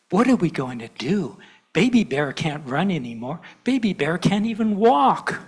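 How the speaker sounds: put-on lively read-aloud for kids at church; storytelling to children. A narrator's reading. Minister reads to gathered children, animated storytelling. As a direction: tell it like a picture book.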